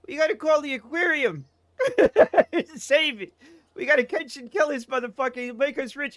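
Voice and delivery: high-pitched excited impersonation; high, urgent mock-accent voice; excited video guy. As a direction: mimic an excitable guy hatching a plan.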